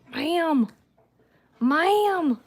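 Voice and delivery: high, drawn-out ma'am; mock-stern, rising high in pitch; chiding the dog. Real, not acted. Woman to her licking dog, chiding.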